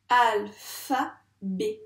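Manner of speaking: "Alphabet" very slow, three separated syllables, firmly voiced. Shown as a demonstration. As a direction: beat out "Alphabet" with pauses between syllables. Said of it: "On tape ça ensemble?". (« Alphabet » scandé lentement, trois syllabes séparées)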